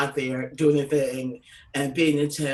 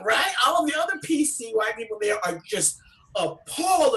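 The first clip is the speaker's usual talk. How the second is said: usual speaking voice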